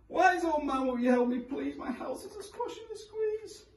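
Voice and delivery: put-on high, begging old woman; pleading old lady. A narrator's reading. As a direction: beg it like a desperate granny.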